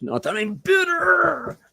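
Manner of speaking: high, slow, strained mock shout; mock bitter outburst. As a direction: cry it out in hammed-up bitterness. Mock outburst about the kidnapping.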